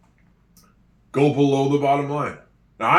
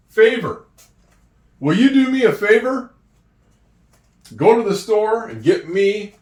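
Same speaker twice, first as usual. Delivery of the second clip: excited; 7 semitones higher, 5 dB louder than usual. Acted. Playful example sentence.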